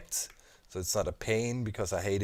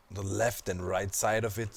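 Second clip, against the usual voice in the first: normal talking voice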